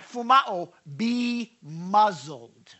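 slow, drawn-out be muzzled; emphatic. Shown as a demonstration. Preacher explaining Jesus' Greek command, slow and heavy. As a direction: lean on each word slowly. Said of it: "Literally means".

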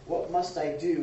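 normal talking voice

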